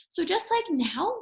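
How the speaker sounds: ordinary talk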